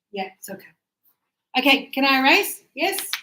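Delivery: ordinary talk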